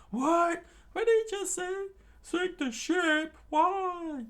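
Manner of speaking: very high, loud squeaky shout; chef screaming. Acted. Cook shocked at Luffy's plan, high loud shriek. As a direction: shriek in disbelief. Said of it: "the chef screamed out".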